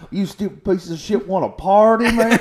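high, loud mock challenge; hammed-up drunken tourist calling out; rowdy party American. Acting the American with a beer outside the store, rowdy mock challenge.

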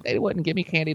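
ordinary talk

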